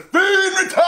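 loud, high excited yell; hyped shout. Real, not acted. Hyped streamer telling viewers about The Fiend, excited shout. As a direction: burst out hyped, breaking into laughter. Hyped over a wrestler's return.

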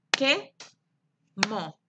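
"Quemó" in two detached beats, que and mó; long pause inside "Quemó"; clapped syllables. Shown as a demonstration. Teacher claps with pupils, "Quemó" split in two. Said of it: "Aplaudan conmigo".

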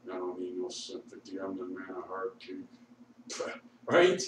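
usual speaking voice

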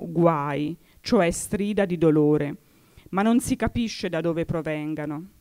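usual speaking voice

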